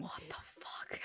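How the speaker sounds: shocked breathless whisper. Real, not acted. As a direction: mouth it in hushed disbelief. Shocked reply to a grim fact.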